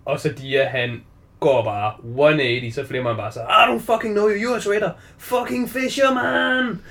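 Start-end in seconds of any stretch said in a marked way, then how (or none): 3.4-6.8 high, drawn-out mock yell of insults in English; retold shouting. Retelling the film scene to a co-host, high mock yell. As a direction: yell it like a kid gone furious at his dad. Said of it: "Så står han bare og råber".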